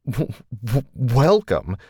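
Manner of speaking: a stunned, stammering echo of welcome; stuttering. A narrator's reading. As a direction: stammer on the w sounds before welcome. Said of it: "stuttered Shane".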